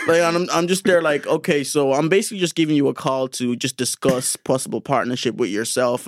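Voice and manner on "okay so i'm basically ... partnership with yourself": lower-pitched corporate phone voice; polite, measured sales-call delivery; corporate accent. Retold or imitated. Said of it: "I go corporate".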